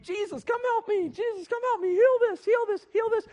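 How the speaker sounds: very high, mock-desperate crowd voice; clamoring plea. Crowd all begging Jesus for healing, hammed-up high pleading. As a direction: beg in a high, loud, hammed-up squeal. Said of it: "Everyone else is gonna be asking".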